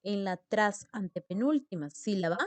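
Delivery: ordinary talk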